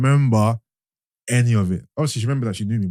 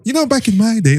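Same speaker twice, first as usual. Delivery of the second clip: high, slightly louder reminiscing voice; high-pitched impression of Sid; 10 semitones higher, 4 dB louder than usual. Host showing how an acquaintance talks, in a high pitch. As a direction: imitate him, pitched right up. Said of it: "he puts on the high pitch".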